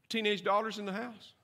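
ordinary talk

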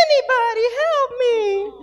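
high, thin imitation of a little boy; crying child's plea. Son begging for anyone to come, high-pitched mock plea. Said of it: "He'd be like".

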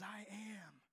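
unvoiced, softly; whispered I am. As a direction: breathe it out. Whispered quote.

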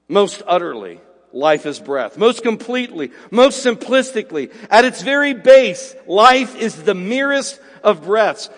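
loud, slow, emphatic pulpit delivery; fervent sermon climax, hammering every word; preaching at full force. Acted. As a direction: drive each phrase home from the pulpit. Preaching builds again.

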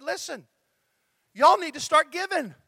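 high, loud Texas twang. Retold or imitated. Pastor quotes a colleague's appeal for giving, Texas twang. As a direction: imitate an emphatic Texas twang. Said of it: "she said it in her Texas twangy voice".

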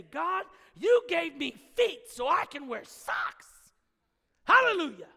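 hammed-up joy over a pair of socks; mock ecstatic praise. Preacher acting a child thanking God for socks, ecstatic.

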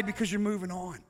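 hushed sermon reassurance. Real, not acted. Preacher drops to soft conviction.

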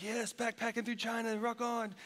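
mock the students' breezy excitement; lightly voiced carefree college kid; gung-ho college kids. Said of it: "They're like".

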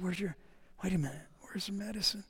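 hushed, anxious whisper; worried whisper. Retold or imitated. Wife realizing the medicine is gone, whispered in worry. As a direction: whisper it as panic sets in.

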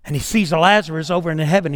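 normal talking voice

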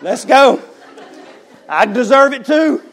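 a half-laughing, rising call to take his share; laughing bravado. Retold or imitated. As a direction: step up loud and cocky. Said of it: "and I was like".

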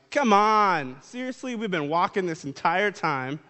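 tired disciples complaining to Jesus, whiny and exasperated; whiny exhaustion. Retold or imitated. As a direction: moan like a worn-out follower begging for rest.

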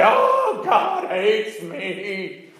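a high shout, then a slow drawn-out groan of despair; despairing cry. Retold or imitated. Churchgoer whose wedding got rained out, crying out in mock despair. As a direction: wail it like the rain ruined everything. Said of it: "and you say".

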